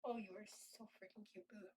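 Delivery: hushed, low-key adoration; soft cooing to cat. Real, not acted. Owner admiring the cat, soft and low cooing. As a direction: quietly murmur affection to a pet.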